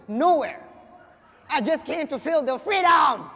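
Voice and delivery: loud, hammed-up triumph; mock-heroic proclamation; triumphant declaration. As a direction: build to a big shout on the last word. Acts triumphant reply at airport.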